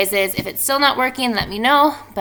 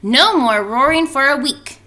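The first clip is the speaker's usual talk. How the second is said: lightly voiced doctor, higher and louder; a hint of a bossy doctor laying down the rule; mock-strict doctor order; stern doctor; 6 semitones higher, 7 dB louder than usual. Doctor voiced in read story.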